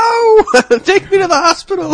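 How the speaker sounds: high, loud mock cry of pain; mock agony shriek. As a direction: shriek like the cheese burned. Blindfold off after the taste test.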